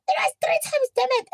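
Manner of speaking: hammed-up high-pitched screaming confession; imitated loud, very high shriek; Karabo. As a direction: scream it out, shrill and exasperated. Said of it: "And Karabo screams".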